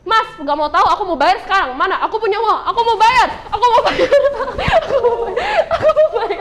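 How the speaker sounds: building from a firm demand to high, frantic repeats; mock annoyed. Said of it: "Jadi ibunya kesel dong".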